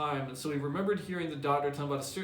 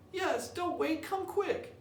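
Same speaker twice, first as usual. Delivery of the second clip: much higher, frantic call down the stairs; panicked boy calling; slower than usual. Acted. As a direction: shout for the aunt in a high panic. Sid urging aunt.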